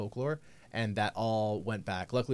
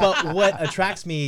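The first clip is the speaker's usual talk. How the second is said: slow, laughing and raised; 8 semitones higher, 8 dB louder, slower than usual. Real, not acted. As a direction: drag it out through laughter. Laughing while telling about dating.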